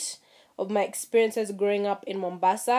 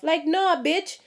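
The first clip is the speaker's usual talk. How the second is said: high, sharp no, bitch; talking back to the friend, a higher sassy no; sassy retort; 6 semitones higher than usual. Real, not acted. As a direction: snap back with attitude.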